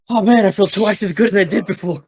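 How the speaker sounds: wounded fighter thanking the healer, high and cheery; relieved comrade. Acted. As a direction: sound grateful and half joking. DM voices Woodchuck thanking healer.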